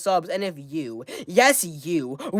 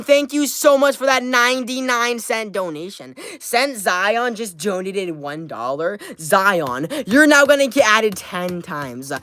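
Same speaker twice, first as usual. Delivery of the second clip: ordinary talk, nothing marked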